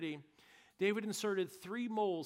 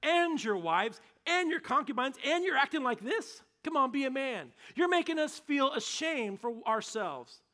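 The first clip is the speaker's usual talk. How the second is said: higher, louder furious scolding; angry rebuke; 7 semitones higher, 7 dB louder than usual. Retold or imitated. Joab storming in to scold the grieving king, loud angry rebuke. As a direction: tear into the king, demanding he act. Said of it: "He says".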